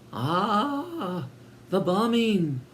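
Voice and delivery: high, theatrical gasp; mock-sympathetic exclamation. A narrator's reading. Twin reacting to the bombing, high and theatrical. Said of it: "exclaimed the second twin".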